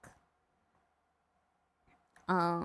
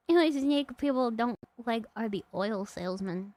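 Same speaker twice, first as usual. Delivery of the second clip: usual speaking voice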